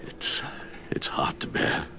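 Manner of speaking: broken whisper of grief; grieving whisper. Acted. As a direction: barely get the words out. Alfred mourns Lucius.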